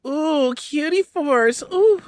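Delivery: high, slow swooning coo; a slow, high lovestruck ooh; swooning villager. Retold or imitated. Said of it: "she's like".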